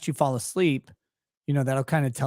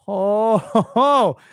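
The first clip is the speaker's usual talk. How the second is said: mock cheer; 5 semitones higher, 6 dB louder, much slower than usual.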